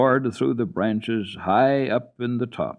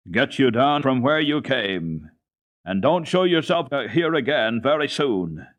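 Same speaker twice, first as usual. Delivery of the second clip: slow, stern command with a raised voice; 6 semitones higher, 4 dB louder than usual. A narrator's reading. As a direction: order her off sternly. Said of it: "said sternly".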